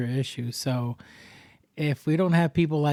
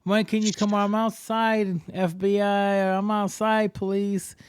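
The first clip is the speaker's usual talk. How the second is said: raised-pitch sing-out begging, hammed up; mock pleading; 5 semitones higher than usual. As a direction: beg the fake police as a joke. Mock begging scammer to arrest him.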